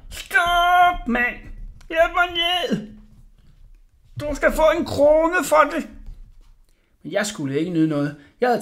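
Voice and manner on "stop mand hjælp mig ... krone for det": high, loud, drawn-out cries; desperate bawling. A narrator's reading. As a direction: bawl after someone running away. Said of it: "jeg hørte ham vræle".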